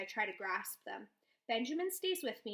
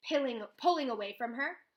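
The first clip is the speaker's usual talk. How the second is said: ordinary delivery, nothing marked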